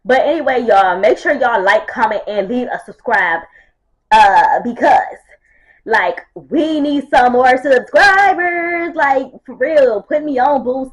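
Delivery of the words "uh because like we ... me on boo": loud pleading. Real, not acted. Begging for subscribers.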